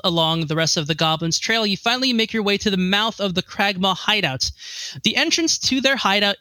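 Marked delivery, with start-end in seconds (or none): none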